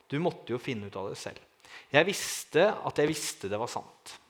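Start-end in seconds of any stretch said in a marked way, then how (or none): 0.1-1.4 soft repeated quote. Retold or imitated. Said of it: "Han sa følgende setning".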